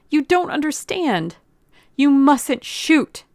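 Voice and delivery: high, loud, urgent plea; begging. A narrator's reading. Girl stopping the gunman from shooting, begging. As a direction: beg desperately, slowing on the last warning. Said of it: "she begged Burkhart".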